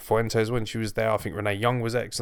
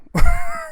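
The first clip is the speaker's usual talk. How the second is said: a loud, high, sudden laugh; high burst of laughter; 10 dB louder than usual. Real, not acted. Vlogger laughing at the fans who call Baszler boring, loud and high.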